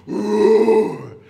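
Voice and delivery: big loud pretend roar; lion roar. Playing lion with the children, loud roar. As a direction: let out a long growling roar. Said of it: "give a roar".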